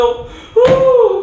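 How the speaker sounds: high, drawn-out mock wail; imitated wail. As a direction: imitate mourners with a long, high "어". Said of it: "사람들이 막 울고 있어요".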